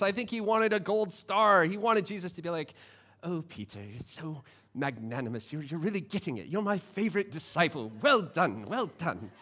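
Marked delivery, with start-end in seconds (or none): 3.2-9.3 lightly voiced old British gentleman, softer; a hint of a posh old Englishman praising a pupil; soft, quiet British accent of an old man's approval; old British guy. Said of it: "why Jesus is an old British guy".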